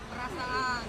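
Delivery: word jumps way up high and goes soft; squeaky punchline. Acted. Punchline of the joke.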